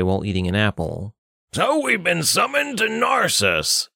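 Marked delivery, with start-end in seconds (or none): 1.5-3.9 high put-on voice, snide. Richter snidely sums up the overheard summons. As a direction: sneer it through Richter's voice. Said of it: "he said snidely".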